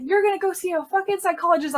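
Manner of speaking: high, quick mock yell; imitating a boss yelling; retold yelling. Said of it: "whole conversation yelling back".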